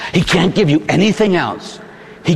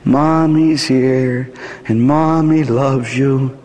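tender lullaby; 5 semitones lower, much slower than usual. Acted. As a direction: soothe a crying baby. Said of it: "she would rock him".

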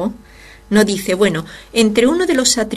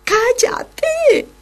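much higher, exasperated scold; exasperated hush; 7 semitones higher than usual. Acted. Apostles telling the shouting man to shut up, exasperated. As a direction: tell him off, fed up. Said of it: "le decían".

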